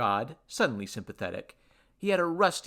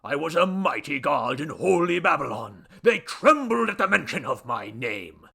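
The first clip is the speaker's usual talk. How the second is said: loud, raised oratory; pompous boastful god; 3 semitones higher, 7 dB louder than usual. Acted. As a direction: boast grandly of ancient might. God boasts of past glory.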